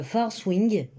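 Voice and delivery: slow, careful English accent on the title; a French reader's self-conscious English accent. Real, not acted. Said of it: "désolé pour mon accent anglais épouvantable".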